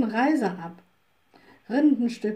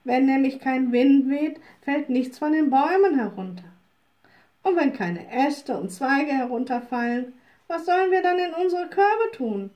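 put-on raised, louder old woman's voice; old woman griping about the missing wind; complaining wood woman; 3 semitones higher, 4 dB louder than usual. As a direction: wood woman explains her empty basket to the witch.

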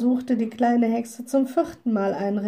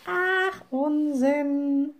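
high-pitched, scoffing witch; little witch scoffing, pitched up; dismissive little witch; 7 semitones higher, much slower than usual. A narrator's reading. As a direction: wave it away in a high voice. Witch dismisses the raven's warning.

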